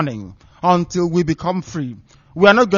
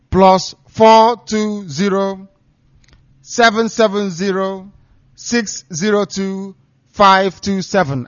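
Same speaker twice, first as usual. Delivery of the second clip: slow, spaced digits read for note-taking; slow number dictation; 3 semitones higher, 5 dB louder, slower than usual. Shown as a demonstration. Announcer to listeners, dictating the number slowly. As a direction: give the phone number digit by digit. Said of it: "I repeat".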